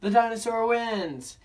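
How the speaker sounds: put-on high, loud cheer of kids; excited children. Children cheering for the dinosaur, high and loud. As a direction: announce the winner with glee. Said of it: "said the children".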